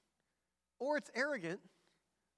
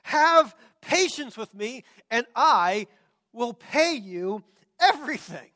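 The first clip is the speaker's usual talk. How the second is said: imploring; 3 semitones higher, 11 dB louder, slower than usual. A narrator's reading. Servant on his knees before his master, imploring loudly. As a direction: beg with drawn-out, emphatic words. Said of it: "imploring him".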